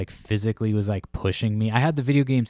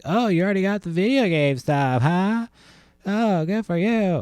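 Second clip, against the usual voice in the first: snide lady voice; 10 semitones higher, 4 dB louder than usual. Retold or imitated. Rival lady mocking the narrator's early video game haul. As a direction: mimic the rival lady sneering. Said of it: "She's like".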